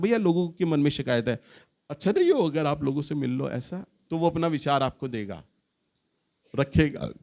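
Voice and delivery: normal talking voice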